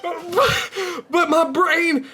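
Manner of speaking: very high, squeezed-out words through laughter; helpless real laughing fit; losing it laughing. Real, not acted. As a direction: push the words out while cracking up. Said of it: "I'm losing it".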